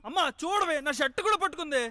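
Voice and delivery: high, loud tattling wail; outraged high-pitched yell; yelling for mother. Acted. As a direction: shout a complaint to mum mid-scuffle. Calling mother during scuffle.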